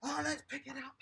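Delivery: ordinary delivery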